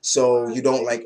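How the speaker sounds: ordinary delivery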